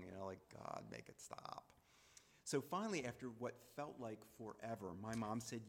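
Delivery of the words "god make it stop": slow, low plea under the breath; retold inner groan, hushed and drawn out; biting his tongue. Son silently enduring sister's badgering, biting his tongue. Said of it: "biting my tongue".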